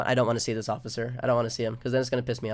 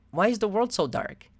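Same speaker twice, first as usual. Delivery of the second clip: put-on child's plaintive question; 7 semitones higher than usual.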